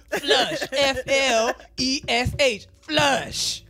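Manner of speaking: "Flush" for flesh, loud and insistent; emphatic "Flush", sure that it is correct. Retold or imitated. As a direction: spell it out slowly, then declare "Flush". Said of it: "he'd be like".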